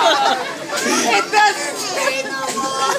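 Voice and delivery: put-on high, slow baby whimpering; blubbering. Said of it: "crying like a baby".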